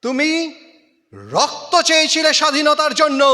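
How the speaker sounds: loud, high, fast recitation; ringing declamation. Acted. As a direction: hurl the line out like a rallying cry. Poem climax, call for blood.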